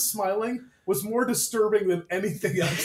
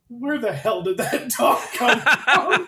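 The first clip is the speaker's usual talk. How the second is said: slow, higher-pitched incredulous question, half laughing; baffled outburst; 6 semitones higher, 4 dB louder, slower than usual. Retold or imitated. Viewer reenacting confusion at the show, laughing incredulous outburst. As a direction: demand it in drawn-out disbelief. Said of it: "I start going like".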